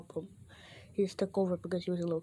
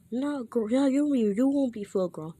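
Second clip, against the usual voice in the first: higher, louder stumbling correction; insistent correction; 6 semitones higher, 7 dB louder than usual. Acted. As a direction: blurt it out, restarting as you go. Correcting himself in trainer's line.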